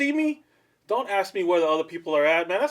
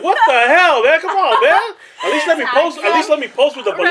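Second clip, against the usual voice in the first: indignant surprise; 9 semitones higher, 7 dB louder than usual. Retold or imitated. Retold reaction to the photo.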